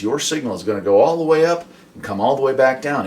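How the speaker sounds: ordinary talk, nothing marked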